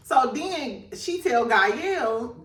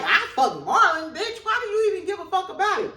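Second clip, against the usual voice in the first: brazen Gael; 8 semitones higher, faster than usual. Retold or imitated. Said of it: "Gael like".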